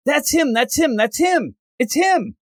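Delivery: excited, high-pitched repeated outburst. Acted. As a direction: act out an eager, excited character. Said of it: "'cause she gets excited".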